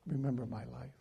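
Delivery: whisper-close plea to God; king begging the Lord softly, quiet plea; quiet prayer. Acted. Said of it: "prayed to the Lord, saying".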